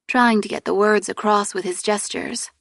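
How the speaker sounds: ordinary delivery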